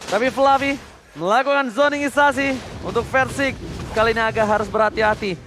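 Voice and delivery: ordinary delivery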